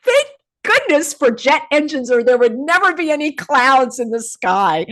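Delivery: high, sarcastic relief; exaggerated thankfulness, not meant; mock gratitude. Real, not acted. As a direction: gush it with heavy sarcasm. Sarcastic praise of jet engines.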